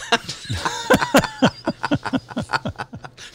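high squealing laughter. Real, not acted. Laugh at own joke.

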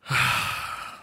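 a long, resigned sigh; in-character sigh. Acted. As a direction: sigh and let the worry go. Worried boy gives in.

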